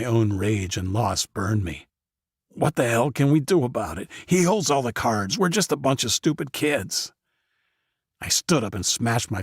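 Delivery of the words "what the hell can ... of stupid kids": higher, louder angry outburst; frustrated outburst. Acted. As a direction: lash out in despair.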